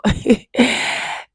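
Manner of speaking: short breathy laugh before the sign-off; excited laugh. Real, not acted. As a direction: let a quick laugh burst out.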